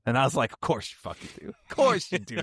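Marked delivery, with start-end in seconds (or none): 0.5-1.5 whispered, fed-up aside; exasperated whisper. Retold or imitated. Exasperated reply to friend. 1.5-2.4 jumps much higher, exasperated; mock exasperation. Exasperated reply to friend.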